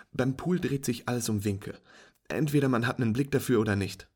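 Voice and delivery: walk a beginner through it brightly; patient instructing friend. Acted.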